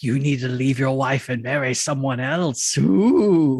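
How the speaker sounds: put-on ghostly voice trailing into a wail; haunt the listener, half in jest; spooky ghost voice. A ghost urging the Count to leave his wife, spooky.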